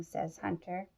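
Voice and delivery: ordinary delivery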